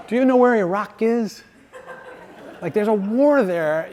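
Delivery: laughing high mock incredulity, imitating a complaining believer; mocking God. Preacher mimics people scolding God, mocking and laughing. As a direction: scoff at God like he's clueless, through a laugh. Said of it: "It's like he doesn't know".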